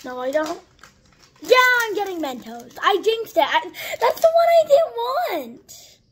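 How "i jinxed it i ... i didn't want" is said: whiny upset. Real, not acted. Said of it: "I'm very upset right now".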